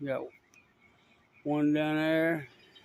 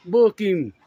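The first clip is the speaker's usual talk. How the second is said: loud cheer; 7 dB louder than usual. Real, not acted. Cheering on the cop.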